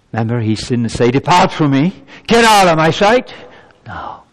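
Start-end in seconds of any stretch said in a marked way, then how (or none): 1.1-3.3 loud, high shout of rejection; acted angry banishing cry; harsh dismissal. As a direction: cast them out, voice raised sharply. Acts the rejection God didn't say.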